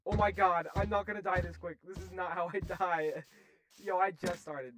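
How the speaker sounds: loud high panic; panicked yelling. Real, not acted. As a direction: cry out as you're being killed. Attacked by player, panicking.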